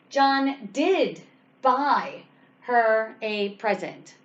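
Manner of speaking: did pressed slow and firm, sentence drawn out; stress on did. Shown as a demonstration. Teacher modelling emphasis on "did" for students. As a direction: lean on "did" and take it slowly. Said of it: "Did is where you emphasize".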